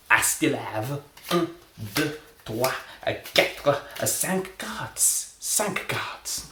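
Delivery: high, slow count in a mock French accent. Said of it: "I will be doing a French accent".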